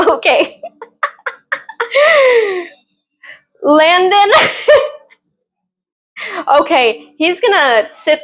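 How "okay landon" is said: higher, laughed-out name; real laughter; laughing. Real, not acted. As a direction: gush through giggles. Laughing about a character.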